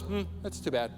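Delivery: soft, low, bored dismissal; apathetic shrug. Retold or imitated. Indifferent God brushing off pain, bored dismissal. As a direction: shrug it off, uncaring. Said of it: "just goes".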